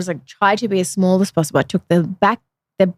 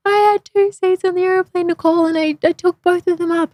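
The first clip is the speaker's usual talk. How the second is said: tearful plea; 11 semitones higher than usual. Retold or imitated. Crying confession to sister.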